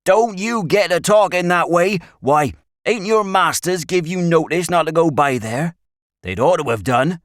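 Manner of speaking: loud, high rustic scolding; alarmed country shepherd. A narrator's reading. Shepherd rebuking Stanley for his plan, alarmed and scolding. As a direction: scold them in anxious country speech.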